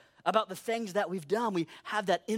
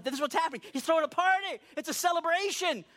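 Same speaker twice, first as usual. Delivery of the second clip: higher-pitched exasperated protest; mock protest; 8 semitones higher than usual. Host protesting to crying party guests, mock protest. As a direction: protest in a raised, acted voice.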